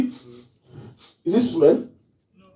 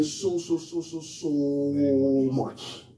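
soft, slow, stretched so so so much; drawn-out so much; 2 semitones lower, 6 dB quieter, slower than usual. Shown as a demonstration. Preacher demonstrating a drawn-out so, soft and fading. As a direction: let each so fade quieter. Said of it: "some of us will say".

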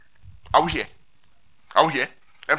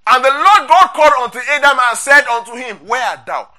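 loud, raised proclamation of the verse; scripture reading; 5 semitones higher, 7 dB louder than usual. Acted. Preacher reads God calling Adam to the congregation, loud scripture reading. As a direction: proclaim it higher and louder, then drop on the question.

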